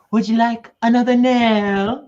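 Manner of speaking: loud, high, drawn-out question; performed catchphrase. Pretend. Introducing herself with one question, loud high catchphrase. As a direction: belt out the catchphrase slowly.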